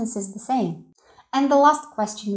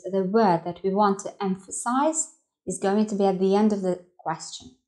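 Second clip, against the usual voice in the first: ordinary delivery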